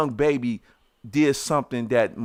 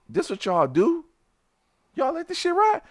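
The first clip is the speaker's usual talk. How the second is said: much higher, indignant question; outraged challenge. Retold or imitated. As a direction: confront the family in disbelief. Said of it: "I'm like".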